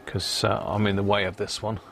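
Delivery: normal talking voice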